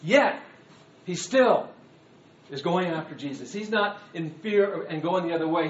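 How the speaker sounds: ordinary talk, nothing marked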